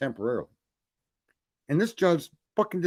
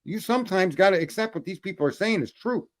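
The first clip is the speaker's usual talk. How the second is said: ordinary talk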